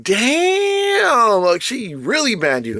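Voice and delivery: high, stretched-out shocked Damn. Retold or imitated. Telling friend his own stunned reply, high drawn-out damn. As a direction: let the first word soar up in disbelief.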